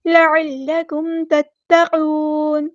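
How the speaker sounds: slow, drawn-out recitation; slow Quranic recitation. Shown as a demonstration. Teacher reciting verse words slowly for students. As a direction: stretch every vowel as in tajweed.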